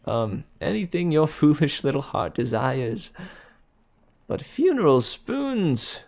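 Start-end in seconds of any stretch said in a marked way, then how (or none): 4.3-6.0 high, slow amused roar; roaring with amusement. A narrator's reading. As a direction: laugh the words out. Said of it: "he roared with amusement".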